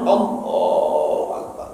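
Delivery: breathy hushed Allahu Akbar; slow whispered prayer call; whispered takbir. Shown as a demonstration. Imitating takbir at prayer start.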